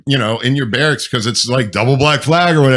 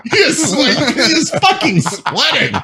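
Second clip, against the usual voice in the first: laughing; 10 semitones higher, slower than usual. Real, not acted. Laughing over photo.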